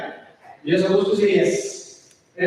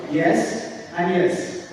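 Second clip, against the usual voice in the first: flat, slow imitated yes; 3 semitones lower, much slower than usual. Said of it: "same tone".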